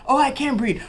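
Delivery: high-pitched imitation of a struggling patient; mock distressed plea. Patient telling staff she can't breathe, high mock distress. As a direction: raise the voice high, imitating a complaint.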